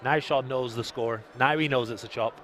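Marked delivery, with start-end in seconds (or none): none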